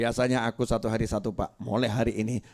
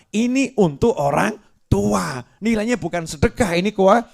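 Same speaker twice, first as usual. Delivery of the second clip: rising fervour; 8 semitones higher, 6 dB louder than usual. Acted. Preacher argues, builds up.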